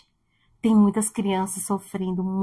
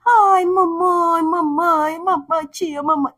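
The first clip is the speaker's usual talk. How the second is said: put-on high, slow, tearful child's wail; imitating a sobbing little boy calling mama; crying child; 6 semitones higher, slower than usual. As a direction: cry for mommy like a small boy. Child crying for mother quoted.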